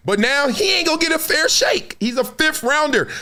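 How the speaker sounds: mock high whine. Mocking how the rookie gets treated.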